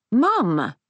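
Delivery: high-pitched, reproving call; hard voice. A narrator's reading. Daughter rebuking her mother at the bedside, hard-voiced. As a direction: sharply pull her up. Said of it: "Safi's voice is hard".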